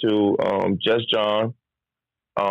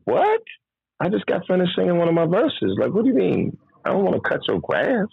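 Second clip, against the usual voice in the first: mock disbelief; 7 semitones higher than usual. Incredulous retelling, high.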